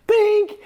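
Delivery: high, loud comic "bink"; imitated impact noise. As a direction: pop it out high like a struck golf ball. Imitates bull hitting man off pile.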